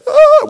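high, loud "oh" wail; imitated overwhelmed sob; mock crying wail. Preacher imitating both men crying, mock crying wail. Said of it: "we're crying, hugging and crying".

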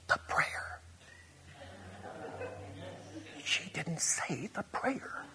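hushed, slow whisper with a laugh; whispered retelling. Retold or imitated. Lady telling the preacher the prayer was missed, whispered. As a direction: whisper it like a scandalized aside. Lady's hushed objection retold.

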